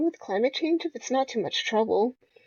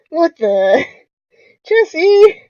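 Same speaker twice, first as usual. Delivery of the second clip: loud outburst leaping high on the name; exasperated yell; 6 semitones higher, 11 dB louder, much slower than usual. Acted. Friend reacting to broken AC reveal, acted yell. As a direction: yell at him in mock outrage. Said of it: "Sure, yell any louder".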